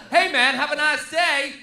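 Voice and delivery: slow, loud mock American cheer; hammed-up American "have a nice day"; American accent. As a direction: overdo the friendly American. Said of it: "they get all that".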